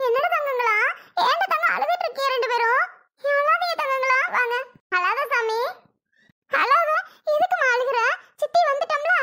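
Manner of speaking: high, cooing aunt voice; soothing crying children. Acted. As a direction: coax the little ones to stop crying. சித்தி அழும் குழந்தைகளை சமாதானப்படுத்துகிறார். (குழந்தைகளைக் கொஞ்சி சமாதானப்படுத்தும் குரல்)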